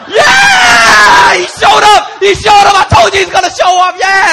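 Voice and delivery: high, loud cheering shout; crowd cheering. Retold or imitated. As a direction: roar like a crowd whose fighter arrived.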